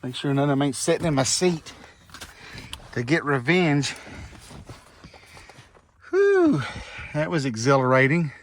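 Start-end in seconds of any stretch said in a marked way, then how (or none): 6.1-6.6 high relieved whew. Real, not acted. Relief after fleeing wasps.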